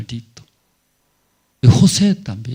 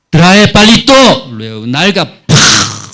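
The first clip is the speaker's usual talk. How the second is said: mock punishing threat; 5 semitones higher, 13 dB louder than usual. Acting out beating with stick.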